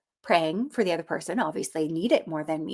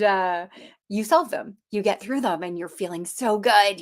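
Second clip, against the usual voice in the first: ordinary talk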